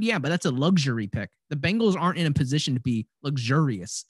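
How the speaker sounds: normal talking voice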